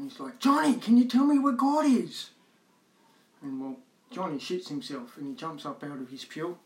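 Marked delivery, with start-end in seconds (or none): 0.4-2.3 full yell, much higher and louder. Acted. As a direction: yell the question at him. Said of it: "he starts yelling".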